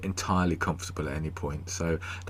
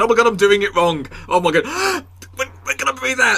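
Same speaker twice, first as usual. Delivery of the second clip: much higher and louder, frantic gasping; mock panic; 7 dB louder than usual. Guest acts out panicking on the breathing tape, high and frantic. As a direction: squeal as if you're suffocating. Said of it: "So I'd be going".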